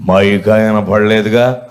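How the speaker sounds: low, slow drawled excuse; absent churchgoer. Retold or imitated. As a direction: imitate her in a low drawl. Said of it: "నిజంగా రాలేదు ఒక ఆవిడ". (సాగదీస్తూ తగ్గు గొంతుతో అనుకరణ)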